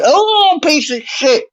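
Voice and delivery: high, cursing at the laptop; mock outburst. Guest reenacting cursing his failing laptop. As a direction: vent at the machine in a high yelp. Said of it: "It's like".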